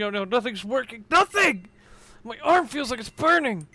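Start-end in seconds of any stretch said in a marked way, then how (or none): 1.1-3.7 loud, higher angry shout; angry outburst. Acted. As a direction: vent fury at the hopeless situation. Infected character despairing.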